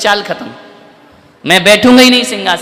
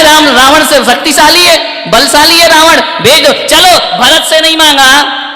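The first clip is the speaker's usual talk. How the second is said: raised, louder, higher preacher's delivery; preaching at full force; 7 semitones higher, 6 dB louder than usual. Real, not acted. Preacher pressing Kaikeyi's scheme on the audience, full force. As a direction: drive the point home to the crowd.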